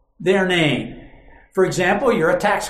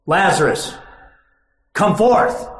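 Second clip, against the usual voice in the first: with authority; slower than usual. Acted. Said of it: "speaks your name with authority".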